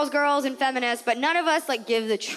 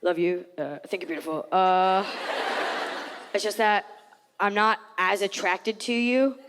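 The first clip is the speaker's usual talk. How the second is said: mock hesitant boyfriend, voice dropped lower; lowered fiance voice; 6 semitones lower than usual. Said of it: "And he goes".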